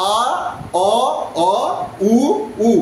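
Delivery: "আ, অ, অ, উ, উ" as isolated pure vowels; measured chant of "আ, অ, অ, উ, উ"; back vowel drill. Shown as a demonstration. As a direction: sound out each of "আ, অ, অ, উ, উ" separately. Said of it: "back vowel শুরু হচ্ছে আ দিয়ে".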